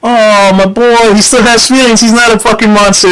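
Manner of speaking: a hint of a fond, protective mom; imitated doting mom. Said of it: "she took my side. She was like".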